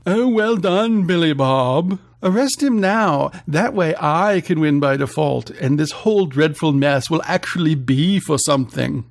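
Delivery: snobbish, exasperated posh voice; pompous snob, sarcastic. Acted. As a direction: scoff like a pompous rich man.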